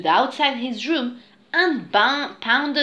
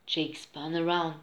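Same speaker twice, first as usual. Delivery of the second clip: ordinary delivery, nothing marked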